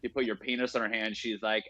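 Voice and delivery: ordinary talk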